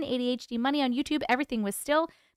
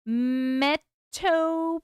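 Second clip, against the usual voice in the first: slow, stretched-out syllables; sounding it out; 4 semitones higher, 4 dB louder, much slower than usual. Real, not acted. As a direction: sound the word out slowly, piece by piece. Sounding out a hard word.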